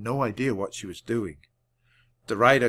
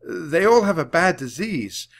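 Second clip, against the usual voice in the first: louder shout over barking; 6 semitones higher, 5 dB louder than usual. A narrator's reading. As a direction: shout above the dog. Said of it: "Jack had to shout".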